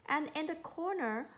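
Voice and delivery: ordinary delivery, nothing marked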